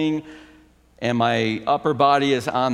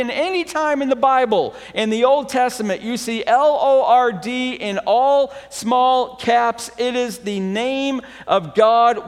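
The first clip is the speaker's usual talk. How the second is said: rising, louder preacher's voice; preaching; 9 semitones higher, 4 dB louder than usual. Acted. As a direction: preach it out to the congregation, building. Pastor preaching on God's name.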